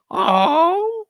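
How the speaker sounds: high, loud wistful cry; sighing parrot cry of longing; high mournful bird cry. Acted.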